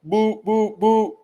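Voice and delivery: high boop beeps; high beeping noises; imitated dial tone. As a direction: mimic the phone going dead. Imitating phone hang-up tone.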